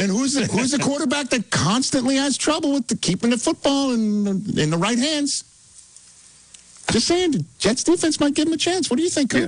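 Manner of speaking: sustained high-pitched argument; playful high-voiced hot take. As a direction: stay up high while making an unlikely case. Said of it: "Pretty high".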